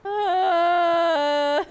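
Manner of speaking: long, high, loud cry of despair; drawn-out wail after falling; anguished wail. Real, not acted. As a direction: let out a crying groan.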